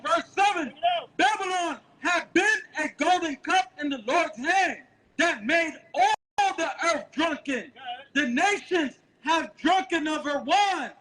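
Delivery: high, slow, ringing scripture reading; street-preaching Bible reader; scripture declamation. Acted.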